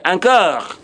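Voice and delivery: ordinary talk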